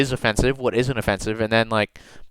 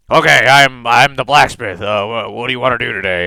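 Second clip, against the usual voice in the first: put-on blacksmith character, starting louder than usual; 4 dB louder than usual. Game master greets players as the blacksmith, put-on character voice. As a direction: improvise a blacksmith greeting a customer, firm opening. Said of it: "what is his voice gonna be?".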